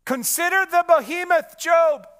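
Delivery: "Consider the behemoth" called out loud and high, commanding; booming voice of God. Acted. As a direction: thunder it down as God speaking to Job. God's command to Job.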